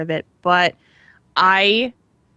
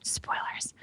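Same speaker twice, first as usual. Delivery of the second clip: hushed, whispered warning; very soft whisper; whispered aside; 15 dB quieter than usual. Pretend. Hushed aside after a plot reveal.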